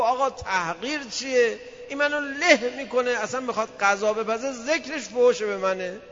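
high complaining plea; aggrieved husband. Retold or imitated.

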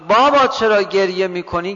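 loud call to her father. Acted. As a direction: call out clearly, pitch lifting on the first word. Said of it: "صدای فاطمه بلند شد". (صدای بلند و خطاب به پدر)

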